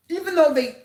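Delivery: choked up, pushing through; choking up. Real, not acted. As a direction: voice catching with emotion. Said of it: "excuse me, I'm choking up".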